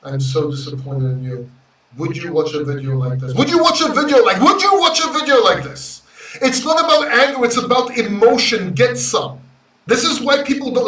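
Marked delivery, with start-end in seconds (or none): 2.0-3.3 unemotional boring delivery. Shown as a demonstration. Host demoing the boring version for his viewers, dull and low. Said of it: "Some unemotional, boring guy on YouTube?". 3.3-6.0 higher and louder, pumped, laughing through it; emotional hyped delivery. Shown as a demonstration. Host demoing the emotional version for viewers, hyped and loud. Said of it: "You want some emotional guy?".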